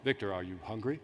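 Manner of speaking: cool, very low and soft voice; smooth, hushed bass, utterly laid-back; Carl. Retold or imitated. Carl asking the driver if he is hungry, cool low voice. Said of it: "Carl says in his cool, melodic voice".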